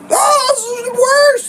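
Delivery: high, loud, drawn-out wail; mock hysteria. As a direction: imitate someone wildly overreacting. Mimics everyone overreacting.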